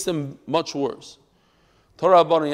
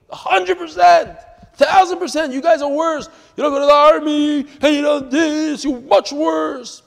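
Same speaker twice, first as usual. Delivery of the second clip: retold shouting; 10 semitones higher, 8 dB louder than usual. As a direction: fire it back at her, loud and high. He shouts back at the woman.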